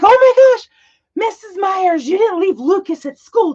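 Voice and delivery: high, loud imitation of alarmed students, gasping out the news; panicked kids. Students panicking to their teacher about the class pet, in a high loud imitated cry. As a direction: hit the pitch high and loud like a worried kid. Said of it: "they're like".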